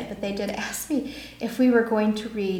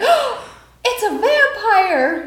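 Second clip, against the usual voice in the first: 1 mock frightened victim; 12 semitones higher, 6 dB louder, much slower than usual. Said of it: "You're like".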